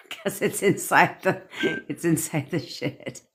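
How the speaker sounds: slow words broken by laughter; laughing. Real, not acted. Speaker explains the rattling glass, laughing. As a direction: try to explain through real giggles.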